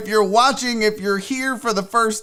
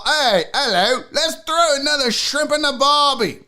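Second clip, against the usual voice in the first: hammed-up Australian accent, pitched up; 5 semitones higher than usual. Shown as a demonstration. Host showing Sydney the mocked accents, Australian accent. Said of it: "she said that my accents".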